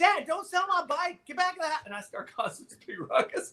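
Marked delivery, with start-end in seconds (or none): 0.0-1.8 put-on much higher, whiny child begging; pleading kid. Boy begs his dad in the garage, high whiny plea.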